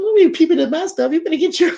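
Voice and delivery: high, loud playful telling-off; mock scolding. Wife catching husband peeking at her treadmill, mock scolding.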